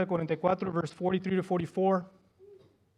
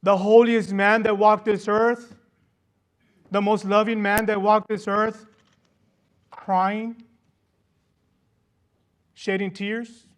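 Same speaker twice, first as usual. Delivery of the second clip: loud, slow and emphatic, pressing each word; impassioned preaching; 3 semitones higher, 9 dB louder, slower than usual. Acted. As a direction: proclaim it to the whole congregation. Preaching at full force. (predicación apasionada y fuerte)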